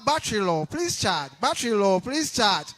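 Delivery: loud repeated device alert; speaker battery announcement. Acted. Speaker breaking into the replayed prayer, loud alert. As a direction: cut in like an automated warning. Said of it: "And then he played it again".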